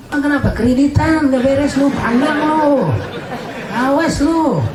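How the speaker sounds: high taunting jeer; high mocking hell voice; mocking voice of hellfire. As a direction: taunt the sinner like the flames themselves.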